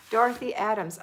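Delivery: ordinary delivery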